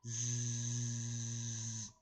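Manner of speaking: a sleepy drawn-out snore; snoring. A narrator's reading. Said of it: "went to sleep on the floor".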